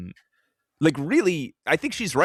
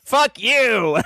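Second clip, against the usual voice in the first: icy taunting kiss-off; Emma Frost voice; 7 semitones higher, 6 dB louder than usual. Retold or imitated. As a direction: sneer it like a cold villain. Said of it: "I love that Emma Frost voice".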